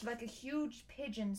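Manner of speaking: ordinary delivery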